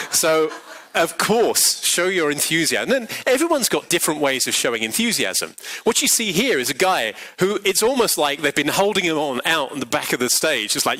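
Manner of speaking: ordinary talk, nothing marked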